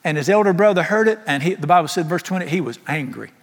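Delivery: ordinary delivery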